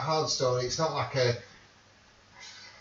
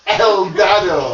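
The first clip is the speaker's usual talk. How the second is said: loud, high, slow mock Russian; hammed-up Russian, laughing; fake Russian accent; 5 semitones higher, 10 dB louder, slower than usual. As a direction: draw it out like invented Russian. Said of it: "That sounds so Russian".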